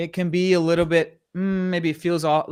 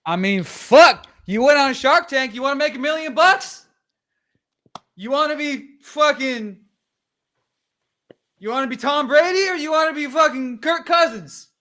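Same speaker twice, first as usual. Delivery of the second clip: fired-up hype; 9 semitones higher than usual. Real, not acted. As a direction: pump them up like a coach.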